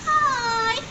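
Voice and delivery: high, soft, drawn-out greeting; a gentle mama bear says hello. Acted. Storyteller as Mama Bear greets kids, soft voice. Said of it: "Mama Bear has soft, meddling, deep voice".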